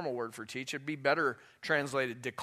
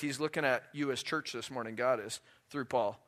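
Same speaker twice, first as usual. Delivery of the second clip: normal talking voice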